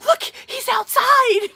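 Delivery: gasping, high, loud mock squeal; gasp, then stretch it into an overexcited wail; coworker freaking out. Coworker spots the sergeant outside, freaking out. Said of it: "my coworker's freaking out".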